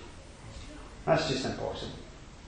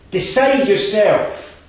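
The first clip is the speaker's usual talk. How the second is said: higher, louder fervent exhortation; preaching at full force; 8 semitones higher, 6 dB louder than usual. Acted. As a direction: drive the congregation to take responsibility.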